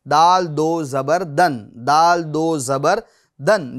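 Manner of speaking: slow दाल दो ज़बर दन without the या; teacher demos the right way; correct spelling. Shown as a demonstration. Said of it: "ये हिज्जे का सही तरीका है".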